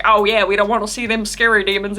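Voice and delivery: hammed-up Southern twang, teasing but fond; mock Southern accent. Friends copy their guide's Southern accent, lightly. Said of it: "mimics accents all the time".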